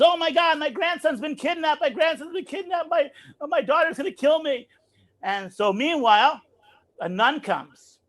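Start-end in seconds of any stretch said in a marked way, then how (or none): 0.0-4.7 high, frantic grandmother imitation; retold panicked cry. Grandmother crying out for her lost grandson, mock panic. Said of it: "She runs and screams".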